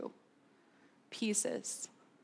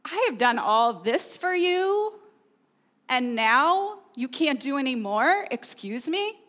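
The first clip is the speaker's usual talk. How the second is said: sarcastic. Acted. Preacher acting out a false voice of God, sarcastic. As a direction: act out a put-upon, sarcastic God. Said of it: "if it's a sarcastic voice".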